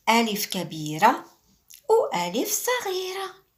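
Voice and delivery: playful contrast of big and little alif; big and small voice. Shown as a demonstration. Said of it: "teacher contrasts big and small alif".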